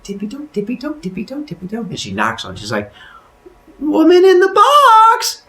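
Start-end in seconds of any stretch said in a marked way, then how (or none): 0.0-1.9 tiny, high, soft voice; sneaky tiptoeing. Acted. Woman sneaking up to the box, soft high tiptoe chant. 3.8-5.4 high, loud, drawn-out hail; calling out. Acted. Woman knocking on the box, high long call.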